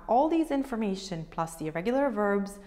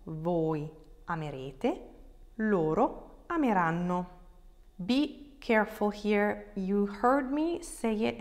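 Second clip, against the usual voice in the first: ordinary talk, nothing marked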